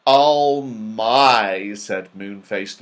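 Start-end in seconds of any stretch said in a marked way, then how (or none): 0.1-1.7 louder, relishing oh my; delighted. A narrator's reading. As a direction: savour the sight of toffee. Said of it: "looking with great delight".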